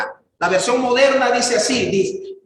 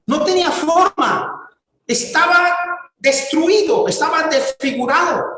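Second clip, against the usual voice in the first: más alto, subiendo con fuerza; predicación intensa; 5 semitones higher than usual. Acted. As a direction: enfatiza la imagen del rostro destruido. (predicación apasionada en tono alto)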